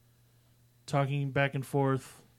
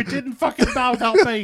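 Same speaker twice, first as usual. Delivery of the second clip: loud, high acted protest; insistent yelling; 10 semitones higher, 9 dB louder than usual. Man bleeding out still arguing his point, yelling. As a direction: shout the denial, hammed-up and indignant. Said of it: "they're yelling back and forth".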